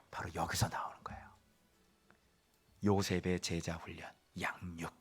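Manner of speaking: sudden hushed whisper. Real, not acted. Preacher leans in and whispers to the congregation, whispering. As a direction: drop to a secret whisper.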